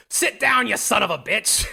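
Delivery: high, loud mock heckle; imitated heckler shout. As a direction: shout it like an outraged audience member. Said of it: "someone shouts".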